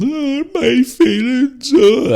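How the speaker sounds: high, slow, whiny fake wail; mock boo-hooing. Shop lady parodying young ones whinging, high mock sobbing. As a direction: wail it like a hammed-up crybaby. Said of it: "crying and boo-hooing and whinging".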